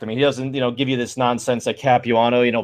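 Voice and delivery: normal talking voice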